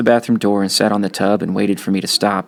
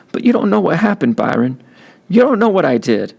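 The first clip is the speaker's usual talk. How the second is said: high, pleading cry; tearful outburst; 9 semitones higher, 3 dB louder than usual. A narrator's reading. Kenny crying to Byron that he doesn't understand. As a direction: insist through tears.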